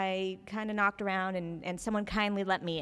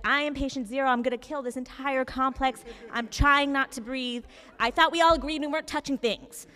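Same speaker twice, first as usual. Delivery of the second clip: mock panic; 6 semitones higher, 6 dB louder than usual. Panicking about covid contagion.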